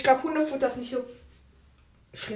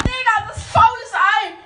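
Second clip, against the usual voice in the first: disgusted outburst; 9 semitones higher, 9 dB louder than usual. Real, not acted. Tastes rotten egg jelly bean.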